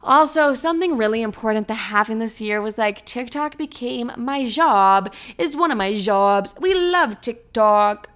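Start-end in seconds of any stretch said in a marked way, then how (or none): 3.0-8.1 put-on playful French accent, drawn out; weird French accent. Podcaster hamming up her TikTok job, weird French accent. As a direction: slip into a mock French accent. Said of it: "doing this weird French accent".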